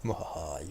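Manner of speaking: low theatrical villain cackle; evil laugh. Acted. Gloating at trapped player.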